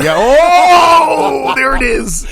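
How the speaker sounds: long, high, loud drawn-out cheer; playful whoop. Real, not acted. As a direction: hoot it out as if the punchline landed. Playful reaction to the joke.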